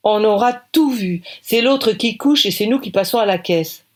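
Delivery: loud sarcastic cry; exclaiming. Acted. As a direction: complain loudly about paying. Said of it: "s'écrie".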